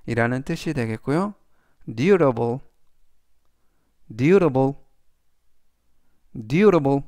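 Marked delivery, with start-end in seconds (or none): 1.9-7.0 "durable" said carefully, DUR-a-ble, louder on repeats; teacher models "durable" for review; model pronunciation. Shown as a demonstration. Teacher reviews "durable" for students, slow and firm. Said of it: "이라고 발음하시면 되겠습니다".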